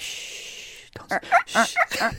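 long breathy "shh"; hushing. Pretend. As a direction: shush the room playfully. Hushing before talk starts.

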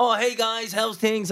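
high, chirpy mock influencer hello; mock youthful greeting. Mocking a grown man on TikTok, high chirpy hello. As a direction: play the grey-haired man on TikTok greeting fans. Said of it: "You're like, Mate, come on".

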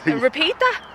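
mock baffled listener; puzzled questioning. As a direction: ask as if you missed it. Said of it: "sometimes we're like".

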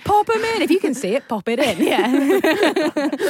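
high, loud, gleeful chant; excited. Real, not acted. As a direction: shout the motto with delight. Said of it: "excited repeat of her motto".

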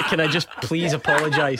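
usual speaking voice

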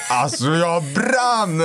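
high, long drawn-out exasperation; retold fury. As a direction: relive the anger, stretching it out high. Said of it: "Jag var så arg".

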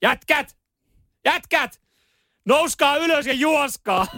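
imitated high-pitched holler; retold shout. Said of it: "Hän huutaa, että".

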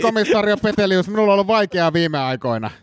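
high-pitched pleading apology, acted; epäilty, pahoitteleva. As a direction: apologise to the inspector as a troubled suspect. Suspect apologises in acted interrogation. (pahoitteleva, anova)

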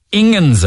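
S=onions; mispronounced onions. Shown as a demonstration. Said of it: "people who want Ingens".

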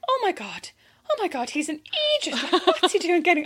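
high, hushed disbelief; mock shock. Listener at the window shocked by the neighbour, hushed. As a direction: gasp in horrified disbelief.

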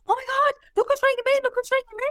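high-pitched flustered squeal of a quote; put-on voice, shrill with alarm; mock panic. Acting out panicking with ringing phone.

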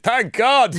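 high, loud gleeful "Thank God!"; mock cheering tweeter. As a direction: cheer it out like a smug online troll. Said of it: "and then somebody tweeted".

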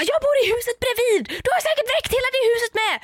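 hammed-up indignant neighbour, high and quick; mock accusing neighbour. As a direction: pin the blame on her like the outraged neighbour.

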